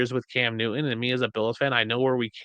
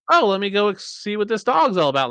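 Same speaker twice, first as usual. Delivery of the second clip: higher, louder, lightly acted curious kitten; lightly voiced kitten; 9 semitones higher, 4 dB louder than usual. Kitten sets off to investigate the big dog, lightly voiced curious kitten. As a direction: play a little kitten piping up.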